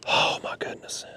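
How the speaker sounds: breathless hushed oh my goodness; awed whisper. Real, not acted. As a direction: whisper it in awe. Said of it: "I don't know why I'm whispering".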